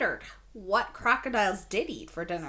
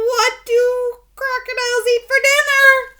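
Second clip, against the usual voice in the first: a hint of higher, louder group voice; cooks asking together; 13 semitones higher, 14 dB louder than usual. A narrator's reading. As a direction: ask like a nervous crowd of cooks. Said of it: "everyone asked".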